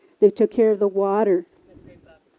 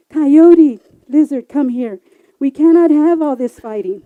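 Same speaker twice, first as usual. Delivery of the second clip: loud, raised call to order; calling the fighters; 4 semitones higher, 12 dB louder than usual. Acted. Moderator loudly calling coyote and lizard to order. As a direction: break up the quarrel firmly.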